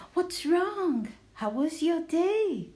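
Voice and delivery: worried mom asking her son; mother's voice. A narrator's reading. Mother asking her son about his day, high and concerned. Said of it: "said his mother".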